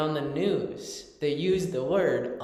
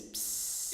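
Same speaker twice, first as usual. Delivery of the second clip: long drawn-out hiss for peeing; drawn-out "psss" noise; imitated peeing sound; 9 dB quieter than usual. Shown as a demonstration. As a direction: hiss it out like a trickle. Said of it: "replicated the sound when they were peeing".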